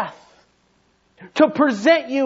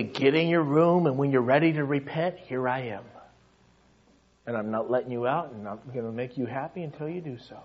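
gentle God; 8 semitones lower, 7 dB quieter, faster than usual. Acted. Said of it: "He might say".

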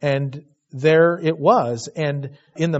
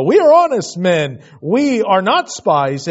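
mocking protest; 4 semitones higher, 8 dB louder than usual. Shown as a demonstration. Preacher mocking the brothers' claim, loud hammed-up protest. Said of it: "I just almost wanna laugh".

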